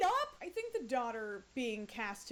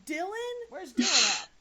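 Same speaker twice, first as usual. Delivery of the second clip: normal talking voice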